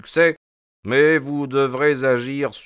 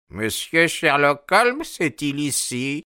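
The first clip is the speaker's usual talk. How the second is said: put-on higher-pitched old man's voice; old mariner asking for someone; wheezy old sailor; 5 semitones higher than usual. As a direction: play an aged, labouring seaman. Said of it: "la respiration était pénible et asthmatique".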